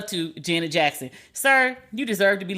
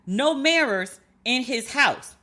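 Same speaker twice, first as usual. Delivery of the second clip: slow quoted jab; 3 semitones higher, slower than usual. Retold or imitated. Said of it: "But I said".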